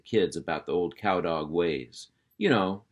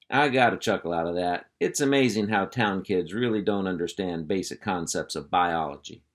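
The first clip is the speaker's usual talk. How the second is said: ordinary delivery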